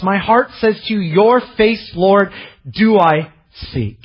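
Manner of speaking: high, slow on "do I seek"; psalm reading. Acted. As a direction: read it as a longing prayer. Reads the psalm.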